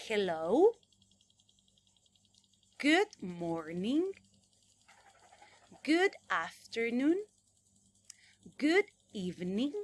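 loud, slow, drawn-out model words; over-articulate each phrase for kids to copy; exaggerated teacher model. Shown as a demonstration. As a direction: classroom repeat-after-me. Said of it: "Yo lo digo en inglés y ustedes intentan repetirlo".

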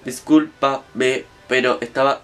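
low, very slow robotic voice; imitating a robot apologizing, low and slow. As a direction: speak like a stiff machine, low and slow. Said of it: "le habla como un robot".

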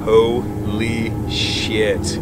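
very slow, drawn-out words; stunned disbelief. Retold or imitated. Guide frozen between tree trunks hearing the bear, stunned and drawn out. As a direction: stretch each syllable as if frozen.

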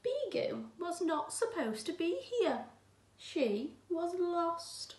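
put-on gentle, clear read-aloud for children; teacher reading a picture book aloud; storybook reading. A narrator's reading. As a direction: read it like a bedtime story to the class.

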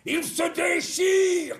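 a hammed-up Michel Bouquet rising to a wail. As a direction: swell from calm to grand theatrical anguish. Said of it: "on peut la dire à la Michel Bouquet".

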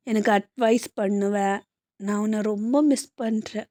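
soft, lonely longing; quiet sorrow. Acted. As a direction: murmur to someone who is gone. Girl missing her dead love. (இறந்த காதலனை நினைத்து மெதுவாக ஏங்கிப் பேசுதல்)

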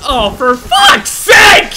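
loud, slow, high yell of frustration; exasperated shout. Real, not acted. Player overwhelmed by sharks, exasperated shout. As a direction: drag it out in disbelief.

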